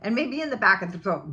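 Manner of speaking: ordinary talk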